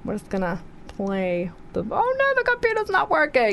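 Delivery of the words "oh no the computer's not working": higher panicked "oh no"; alarmed. Real, not acted. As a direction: react to a tech fail on air. Computer fails on air.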